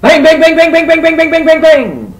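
very loud, high rapid-fire bangs; machine-like burst of high, shouted bangs; banging noise. Acted. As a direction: hammer the word out loudly, high-pitched. Acts out the mystery banging noise.

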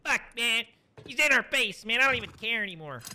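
mock whiny high rant from a kid who rage quit; high, fast mock tantrum; imitating the raging kid. Streamer imitating the kid who rage quit, mock whiny. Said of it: "he was like".

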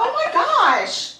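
high, slow laughing oh my gosh; amused disbelief. Real, not acted. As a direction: let the laugh lift it high. Reacting to the apology.

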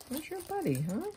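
high cutesy voice to a lost earring; cutesy baby talk. Pretend. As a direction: coo at it like a little pet. Talking to a lone earring.

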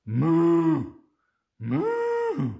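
cow moo. Pretend. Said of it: "He can sound like a cow".

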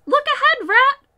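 high, loud warning shout; sudden cry. A narrator's reading. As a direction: yell out a sudden alarm to the rat. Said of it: "cried the mole suddenly".

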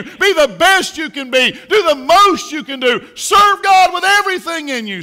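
high, loud, slowed shouting; preaching at full force. Real, not acted. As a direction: pour everything into the charge. Urging parents to admonish children.

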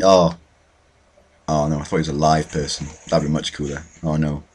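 normal talking voice

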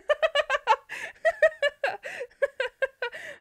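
high, loud burst of laughter; squealing laugh at own blunder. Real, not acted. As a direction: laugh hard at yourself.

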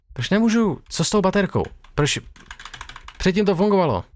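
high frustrated complaining; exasperated. Real, not acted. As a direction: whine at the broken flashlight. Frustrated with broken flashlight.

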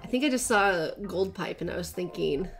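ordinary talk

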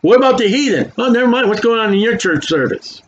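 ordinary talk, nothing marked

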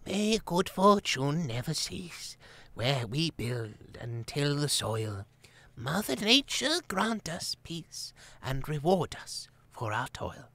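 put-on quavery old voice. A narrator's reading. Ancient Abbess recites grace, slow and quavery. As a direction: recite grace like a frail elder. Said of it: "quavery voice".

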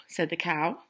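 ordinary delivery, nothing marked

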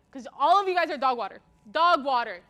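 loud, scornful Valorant-accent drill. Shown as a demonstration. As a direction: hammer out the insult in Valorant accent. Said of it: "Let's start with our first word".

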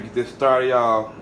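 usual speaking voice

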